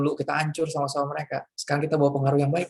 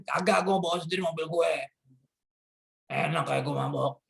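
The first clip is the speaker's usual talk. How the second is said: put-on stubborn drunk. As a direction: drag it out like a drunk. Drunk refuses.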